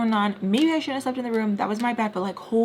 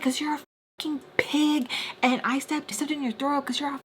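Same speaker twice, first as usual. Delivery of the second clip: going off; 6 semitones higher, 3 dB quieter than usual. Retold or imitated. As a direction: reenact laying into her with rapid insults. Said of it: "I'm just going off on her".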